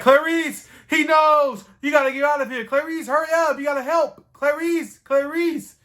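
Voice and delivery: high, panicked pleading; a frightened friend begging her to run; frantic Grover. Retold or imitated.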